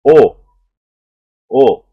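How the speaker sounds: O with lips slightly stretched; loud, held O vowel with stretched lips; teacher modelling the vowel loudly. Shown as a demonstration. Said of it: "eu faço um pequeno estiramento dos lábios".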